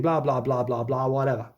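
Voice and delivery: dismissive. Real, not acted. Dismissing the article.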